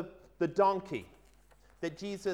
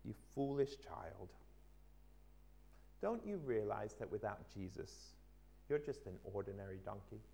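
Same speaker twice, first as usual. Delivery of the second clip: lower, soft gentle mother; gently; 7 semitones lower, 9 dB quieter than usual. Acted. Mother donkey gently corrects her son, low and soft. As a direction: say it tenderly, like a mother to her child. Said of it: "gently said".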